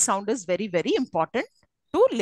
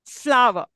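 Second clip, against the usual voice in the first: "Flower" with FLOW stressed, falling after; Flower, first syllable stressed; 4 dB louder than usual. Shown as a demonstration. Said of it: "always the first syllable is".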